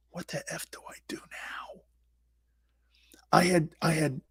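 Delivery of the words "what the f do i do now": hushed, nervous whisper; panicked whisper. Retold or imitated. Rookie whispering to himself in panic. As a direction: whisper it so nobody hears. Alone with his new helmet.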